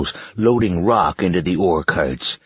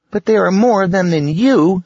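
put-on high, loud girl's voice; a bold little girl speaking up; 6 dB louder than usual. Girl character voice.

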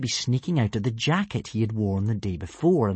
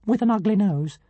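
pompous tiny dragon; 9 semitones higher than usual. A narrator's reading. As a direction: announce yourself like a pint-sized deity.

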